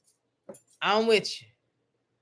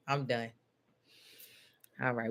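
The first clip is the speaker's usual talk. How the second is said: ordinary talk, nothing marked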